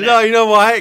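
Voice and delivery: loud, high, defensive cry; loud protest. Real, not acted. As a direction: shout back in protest. Defensive outburst.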